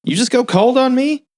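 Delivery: high-pitched imitated reproach; mock hurt accusation. Pearl to the man who wants to leave, mock hurt. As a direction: pitch it way up as a wounded question. Voicing Pearl feeling rejected.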